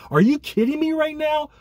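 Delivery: can't believe the price; incredulous. Real, not acted. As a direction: fan outraged at training camp ticket prices, incredulous. Fan outraged at ticket prices.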